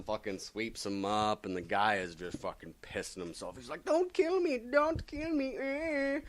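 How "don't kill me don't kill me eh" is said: high, whimpering plea for mercy; terrified begging. Retold or imitated. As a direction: beg for your life in a squeaky panic, near tears. Terrified thug begs Venom.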